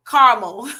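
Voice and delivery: Caramel squeezed into two syllables, "CAR-mul". Retold or imitated. Reading a viewer's word aloud, Caramel said CAR-mul. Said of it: "That's the way you pronounce".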